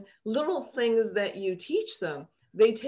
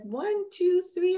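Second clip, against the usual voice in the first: gentle counting; 11 semitones higher than usual. Shown as a demonstration. Coaching child counting feeling.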